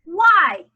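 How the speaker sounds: Y said loud and high; accented letter. Shown as a demonstration. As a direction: punch Y. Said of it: "accent every other letter".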